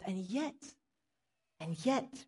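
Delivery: ordinary delivery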